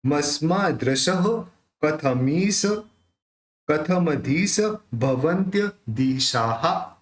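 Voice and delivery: careful visarga pronunciation; visarga demo. Shown as a demonstration. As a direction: sound out दिशाः with a final हा echo. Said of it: "विसर्ग है यहाँ पे उसका उच्चारण ह करेंगे".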